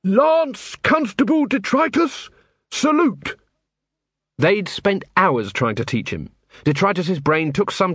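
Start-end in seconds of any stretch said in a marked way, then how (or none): 0.0-3.4 high, drawn-out parade-ground bellow; bellowed command. A narrator's reading. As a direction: call the order out slowly, pitched right up. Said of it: "hands on his waist and bellowed".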